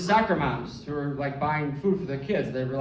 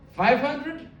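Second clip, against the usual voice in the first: much higher incredulous question; scandalized haggling grandma; 12 semitones higher, much slower than usual. Retold or imitated. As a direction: balk at the price.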